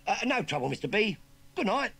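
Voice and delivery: high, loud Cockney goodnight; cheery Cockney call. Acted. Urchin calls goodnight across the yard in Cockney. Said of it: "called the youngster".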